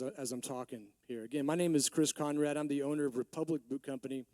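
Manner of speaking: ordinary delivery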